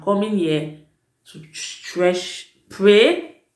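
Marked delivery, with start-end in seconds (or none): none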